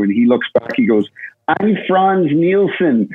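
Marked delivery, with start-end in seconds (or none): 1.5-3.1 slow, higher mock Danish accent. Teammate quoting Franz Nielsen introducing himself in a slow Danish accent. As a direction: drawl out his name in a light Danish accent. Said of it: "and he had that accent".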